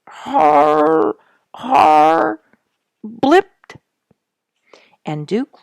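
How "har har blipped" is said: much higher, louder strangled blips and hars; mock gurgling cry. Narrator imitating Duke's mouth-stuck noises, strangled. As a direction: sound like a clogged sink gurgling. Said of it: "If I were to spell the sounds".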